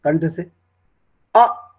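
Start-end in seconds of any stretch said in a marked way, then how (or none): 1.3-1.7 a loud open "अ" from the throat; guttural "अ" demo; throat vowel a. Shown as a demonstration. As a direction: push "अ" straight out of the throat. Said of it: "तो सीधा कंठ से आया".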